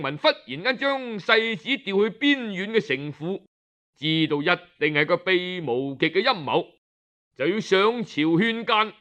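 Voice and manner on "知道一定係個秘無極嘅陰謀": plain reading voice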